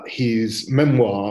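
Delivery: normal talking voice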